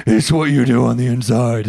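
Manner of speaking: lightly voiced brooding superhero; a hint of a deep vigilante voice; Batman. Fan quoting the hero's big line, mock deep superhero voice. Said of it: "in my deep Batman voice".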